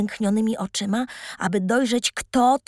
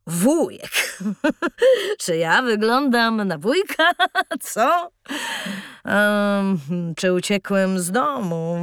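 tramp chuckling through the words; hearty laughter; 2 semitones lower, slower than usual. Acted. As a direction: laugh warmly while answering. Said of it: "roześmiał się serdecznie".

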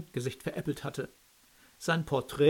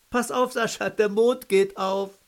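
loud mocking call-out; teasing call; 6 semitones higher, 7 dB louder than usual. A narrator's reading. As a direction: jeer at him across the room. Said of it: "Wolf rief lachend".

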